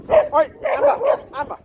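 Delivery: a loud, high, drawn-out Oi to the dog; calling out. Real, not acted. Owner shouting at his dog Amber across the walk, loud call. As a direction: yell across the field to get her attention.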